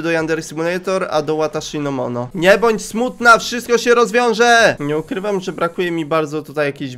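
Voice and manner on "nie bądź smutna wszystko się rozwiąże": slow, loud, high consoling call; loud slow mock pep talk; hammed-up cheering. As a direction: cheer her up, dragged out and sweetly loud. Cheering sad character.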